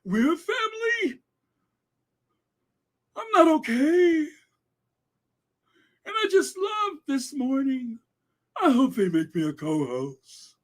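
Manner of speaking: high, quavering mock sob; mocking Allison's tears. Mocking Allison on the show, high mock sobbing. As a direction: wail it like fake crocodile tears. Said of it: "She's all getting emotional and in tears".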